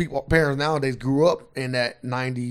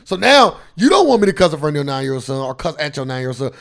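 ordinary talk, nothing marked

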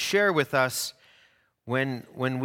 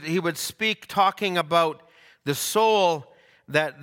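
ordinary talk, nothing marked

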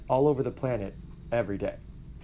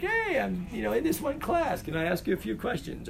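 shy polite stranger; 7 semitones higher than usual. Retold or imitated. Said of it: "just be like".

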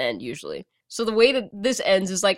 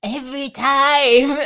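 slow villain voice; 5 semitones higher, 5 dB louder, slower than usual. Retold or imitated. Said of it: "He says".

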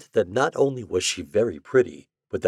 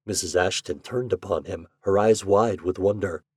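ordinary delivery, nothing marked

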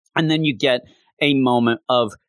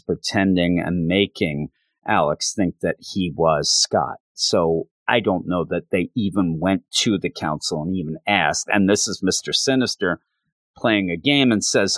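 ordinary delivery

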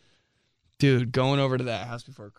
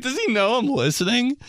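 higher, laughing as you ask; laughing disbelief; 11 semitones higher than usual. Retold or imitated. Laughing disbelief while eavesdropping.

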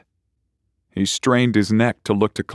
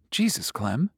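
muttered; 5 semitones higher, 6 dB quieter than usual. Acted. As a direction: mutter it, caught off guard. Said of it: "he muttered".

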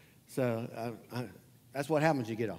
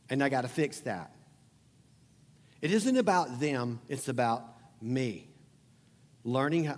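normal talking voice

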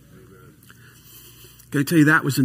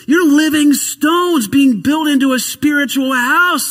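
proclaiming scripture; 8 semitones higher, 5 dB louder, slower than usual. Real, not acted. Quoting scripture, raised voice.

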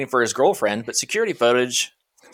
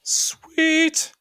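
much higher, bright delighted "Sweet"; excited "sweet"; 4 dB louder than usual. Real, not acted. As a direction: react with delight. Happy reaction to order news.

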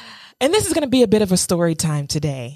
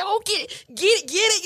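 high, cooing "pose, pose"; proud mama voice; 12 semitones higher, faster than usual. Retold or imitated. Posing her date for a photo, a high mock-proud mama coo. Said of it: "like his mama".

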